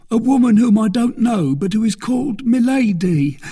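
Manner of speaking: high, loud, anxious captive; confessing bandit. A narrator's reading. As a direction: blurt out the confession to save yourself. Frightened bandit confesses to captor.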